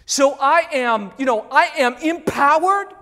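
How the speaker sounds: cocky and loud, swelling with pride; hammed-up confident claim; mock boast. Pastor mocks what Caspian didn't say, boastful and loud. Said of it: "Caspian does not say".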